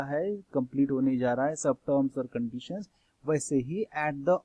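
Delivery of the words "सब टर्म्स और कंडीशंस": normal talking voice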